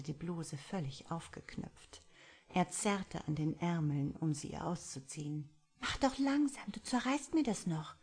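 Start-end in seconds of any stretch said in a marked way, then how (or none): 5.8-8.0 higher, whiny complaint; woman grumbles at her rough lover; grumbling. A narrator's reading. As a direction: pout it out at him. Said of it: "maulte sie leise".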